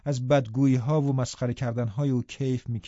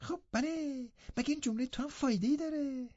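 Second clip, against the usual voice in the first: much higher, much softer mocking voice; mocking imitation of Hareton; 13 semitones higher, 9 dB quieter than usual. Linton mimics Hareton to make Catherine laugh, high and soft. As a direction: ape his rustic cousin. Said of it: "لینتون ادای هیرتون را درآورد".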